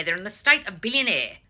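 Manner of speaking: normal talking voice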